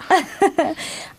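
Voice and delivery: tearful laugh. Real, not acted. Tearful laugh after crying.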